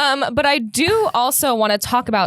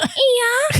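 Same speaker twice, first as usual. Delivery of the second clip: high, rising mimicked yeah; imitated "yeah"; 12 semitones higher than usual. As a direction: mimic what was overheard, pitched way up. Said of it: "I heard you go".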